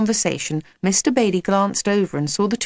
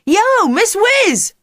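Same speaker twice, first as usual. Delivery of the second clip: high, drawn-out loud holler; called out; 7 dB louder, much slower than usual. A narrator's reading. Jack to a daydreaming Miss Whiz, calling out. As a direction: hail her with a long yell. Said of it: "Jack called out".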